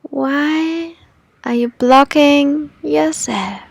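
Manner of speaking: ordinary delivery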